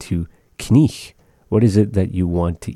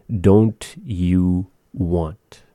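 very slow, drawn out; much slower than usual. Shown as a demonstration. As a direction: stretch "don't you want?" very slowly.

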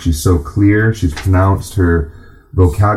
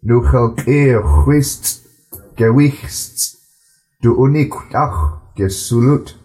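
slow, measured reading in a steady flow; slow careful reading; 5 semitones higher, slower than usual. Shown as a demonstration. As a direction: read each word slowly and clearly, in a smooth flow. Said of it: "I'm gonna read it a bit slower".

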